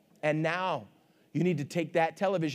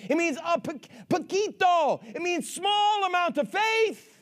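emphatic preacher voice, higher than normal talk; full-force preaching; 7 semitones higher than usual. Real, not acted. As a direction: punch each phrase out to the congregation.

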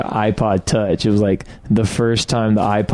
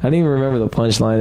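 normal talking voice

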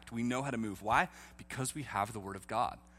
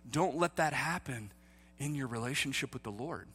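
ordinary talk

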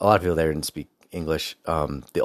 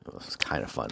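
whisper; 6 semitones lower, 11 dB quieter than usual. Shown as a demonstration. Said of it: "repeated after normal take".

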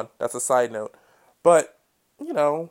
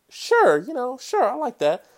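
eager investor voice; 9 semitones higher, 3 dB louder than usual. Retold or imitated. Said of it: "And I say".

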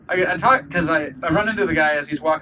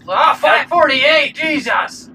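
higher, louder, stretched cry of disbelief; shocked shout; 8 semitones higher, 6 dB louder, much slower than usual. Real, not acted. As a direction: yell the price out in real shock. Shocked by gas price sign.

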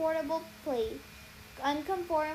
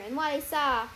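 plain reading voice

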